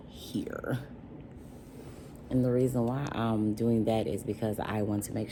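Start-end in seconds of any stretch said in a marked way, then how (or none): 0.1-0.8 a breathless whispered word. Real, not acted. Vlogger listing her quotes on screen, whispered aside. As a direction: drop to a whisper on the last word.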